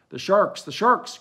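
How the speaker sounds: high, loud cry of alarm. A narrator's reading. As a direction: shout a warning in fear. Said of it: "cried a voice".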